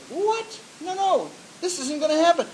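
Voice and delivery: high-pitched indignant protest; acted outrage, pitched well up; outraged disbelief. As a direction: object in high, mock indignation. Acts out David's outrage at story.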